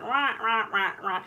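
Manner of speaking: gruff little quacking rah sounds; duck squawk imitation. Imitating her grumpy drake's quack for viewers, rough squawks. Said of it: "the way he talks".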